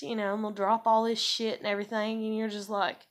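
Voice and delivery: usual speaking voice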